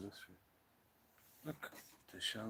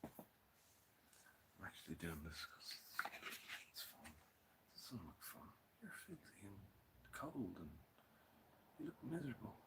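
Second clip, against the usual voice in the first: quiet murmur; 8 dB quieter, slower than usual. Pretend. Mock self-talk in low murmur.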